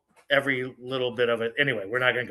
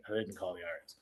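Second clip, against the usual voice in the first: low, much softer confidential aside; hushed aside; 6 semitones lower, 16 dB quieter, much faster than usual. Real, not acted. Speaker admits to audience he didn't call, hushed aside. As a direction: drop right down to let listeners in on it.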